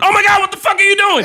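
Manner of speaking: high, fast outraged shriek; yelling at a kid. Acted. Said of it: "I should never yell at another kid".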